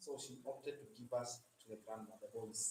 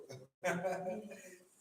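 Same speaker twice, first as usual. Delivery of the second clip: laugh; 4 semitones higher, 5 dB louder than usual. Real, not acted. Laughs after her own joke.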